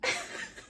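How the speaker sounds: laugh. Real, not acted. Nurse's news surprises the room.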